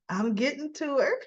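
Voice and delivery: pleased with himself, sing it up; gleeful thought. Retold or imitated. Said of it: "He's thinking".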